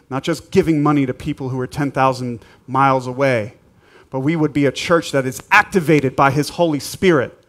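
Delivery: ordinary talk, nothing marked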